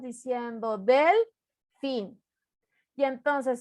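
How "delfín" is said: delfín said slowly as DEL, pause, FIN; split into syllables. Shown as a demonstration. Teacher dividing a word for the class, syllable by syllable. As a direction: break delfín into two drawn-out syllables. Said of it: "dividir la palabra en sílabas".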